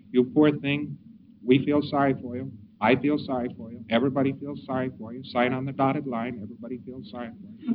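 soft pity, fading to a near hush. Shown as a demonstration. Lecturer demos pity again, fading hush. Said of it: "you say it in a lot of ways".

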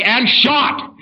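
high, loud, emphatic agreement; matching anger. Acted. Salesman matching the angry client's tone, loud and high. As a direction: top his fury to win him over.

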